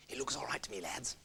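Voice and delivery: soft wary whisper. Acted. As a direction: whisper into the dark kitchen. Lone hunter whispers in kitchen.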